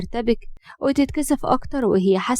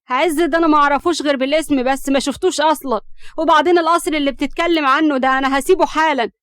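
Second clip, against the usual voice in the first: raised, louder heated denial; indignant; 5 semitones higher, 4 dB louder than usual. A narrator's reading. Hams denies knowing Ezz, indignant. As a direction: protest hotly, fed up.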